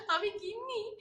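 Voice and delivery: high, amused "আমি গিন্নি?"; giggly disbelief. Real, not acted. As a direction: ask it in laughing disbelief. Reacts to "wife" comment.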